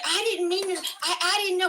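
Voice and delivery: high-pitched mock apology; imitated flustered woman. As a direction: stammer a put-on, helpless excuse.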